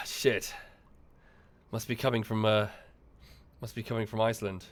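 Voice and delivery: ordinary talk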